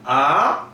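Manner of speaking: 아 rising upward; second tone. Shown as a demonstration. Teacher running through the tones, second one rising. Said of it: "일성부터 사성까지".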